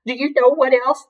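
high, tearful challenge; offended cry. A narrator's reading. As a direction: start into another hammed-up tirade. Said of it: "she cried".

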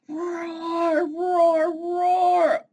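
high, loud, strained roaring; hammed-up storybook bear. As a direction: roar to scare a little bird. Bear roars at Birdie.

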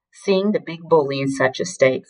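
plain reading voice

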